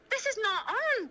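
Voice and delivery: high-pitched, upset protest; real rising anger. Real, not acted. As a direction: voice climbing as anger builds. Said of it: "starting to get angry now".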